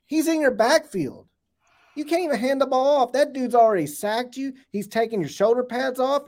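real excitement building as he raves; excited. Real, not acted. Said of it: "I'm getting excited talking about it".

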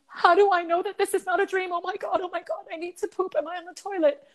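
high-pitched panicked rambling, acted out; freaking out. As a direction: replay your panic in a very high voice, pleading to know it's real. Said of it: "I start freaking out".

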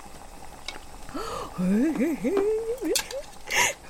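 high, drawn-out excited gasp; a gasp as a delicious smell hits; gasp of delight. Acted. Smells ramen, dramatic gasp.

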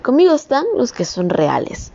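ordinary talk

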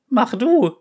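high, loud yell; cat shouting demand. Acted. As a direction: yell the order. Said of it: "schrie sie".

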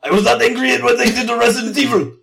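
put-on a half-hearted Japanese accent on a sad quote; mock Japanese accent. Host reading Mikami's quote to co-host, in a mock Japanese accent. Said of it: "can you do a Japanese voice".

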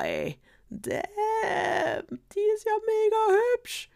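very high, slow, thrilled dwarf voice; excited squeal. Acted. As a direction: gush over her in a squeaky high voice.

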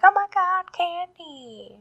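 high, excited squeal; excited kids. Retold or imitated. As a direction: cheer for the candy. Boys excited for candy.

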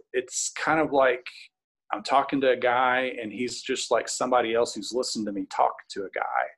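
plain reading voice

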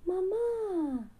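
high happy cry for mum; calling out. Acted. Little elephant calls out to its mother, high cry. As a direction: call out high to mummy. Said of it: "grita el cachorro".

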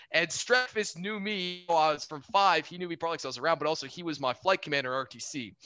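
usual speaking voice

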